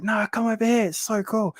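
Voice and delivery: high-pitched enthusiastic urging; excited coaxing. Retold or imitated. Reptile fan urging reluctant tattooed guy over, excited. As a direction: coax them over, voice pitched right up.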